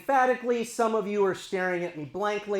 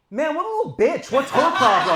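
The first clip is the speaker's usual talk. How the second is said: louder, higher mock grumble; snarky parent gripe; exasperated aside; 6 semitones higher, 8 dB louder than usual. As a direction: parents griping about their teen, mock exasperated. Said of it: "under our breaths".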